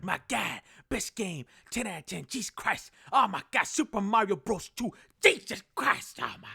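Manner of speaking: comic game reviewer building to yells; over-the-top reviewer impression; Video Game Donkey. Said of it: "Like, the way he talks".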